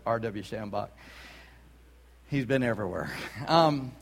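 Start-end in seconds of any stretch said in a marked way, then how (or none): none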